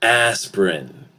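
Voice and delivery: ASPIRIN as AS-prin; two-syllable ASPIRIN for repeating; reduced vowel. Shown as a demonstration. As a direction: elide the middle vowel in ASPIRIN. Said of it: "One more time".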